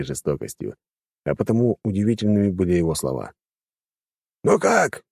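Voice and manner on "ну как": high, outraged protest; indignant outburst. Acted. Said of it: "возмутился".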